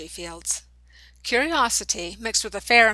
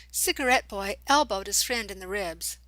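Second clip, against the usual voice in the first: plain reading voice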